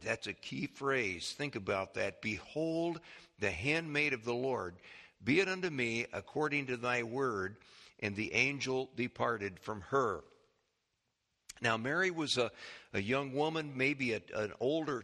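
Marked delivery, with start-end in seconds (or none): 2.2-10.2 lower, measured reading of Bible verses; unhurried, low pulpit reading of scripture. Acted. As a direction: read the passage solemnly, like a Bible reading. Resumes reading scripture passage.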